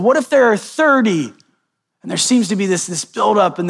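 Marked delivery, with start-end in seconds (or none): 0.0-1.3 higher-pitched mock haggling, retold; hopeful bargaining plea. Abraham haggling with God to spare the town, imitated higher-pitched plea. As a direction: push the offer up high, imitating a bargainer.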